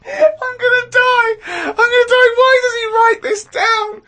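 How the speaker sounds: high, slow crying laughter; dying with laughter; laughing. Real, not acted. Helpless laughter at diary.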